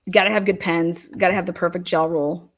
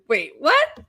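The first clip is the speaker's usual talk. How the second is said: retold surprise; 8 semitones higher than usual. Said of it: "I said".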